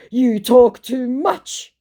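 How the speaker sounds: high, loud and dragged-out; mock scammer complaint. Scammer scolds the doubter, mock indignant drawl. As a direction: stretch each word in mock indignation.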